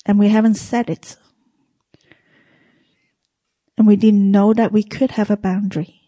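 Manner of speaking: hushed church prayer. Acted. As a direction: pray it gently to the Lord. S0 closing prayer.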